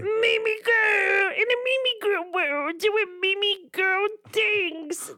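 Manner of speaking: put-on high, slow squealing baby talk; gushing baby talk to a dog. Shown as a demonstration. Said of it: "that wasn't even a good one".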